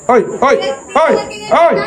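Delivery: ordinary talk, nothing marked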